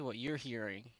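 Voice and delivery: usual speaking voice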